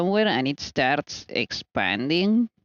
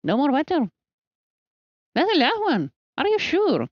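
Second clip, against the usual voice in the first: talks way up high to the pet, mock-stern; squeaky baby-talk scolding; 12 semitones higher than usual.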